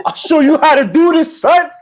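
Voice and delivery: high, loud swaggering rapper voice. Retold or imitated. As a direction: brag it like a rap star. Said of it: "All y'all sound like [name]".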